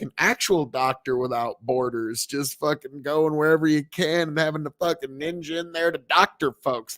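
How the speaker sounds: ordinary delivery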